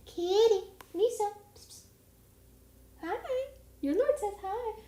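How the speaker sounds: high, loud cooing at the pet. Real, not acted. Calling and greeting the cat.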